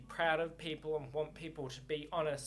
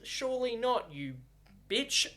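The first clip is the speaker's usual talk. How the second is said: higher and louder jab at the queen; mock outrage; 5 semitones higher, 4 dB louder than usual. Real, not acted. Reader ranting at Queen Mary, mock angry. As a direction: spit out the insult in playful outrage.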